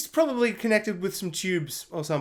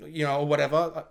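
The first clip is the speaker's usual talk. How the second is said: normal talking voice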